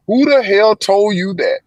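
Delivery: high, slow, loud outraged demand; imitating furious mother. Mother explodes at her son, loud outraged high demand. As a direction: rear up in outrage at the boy. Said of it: "she went crazy".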